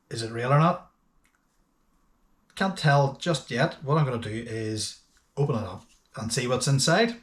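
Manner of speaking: ordinary talk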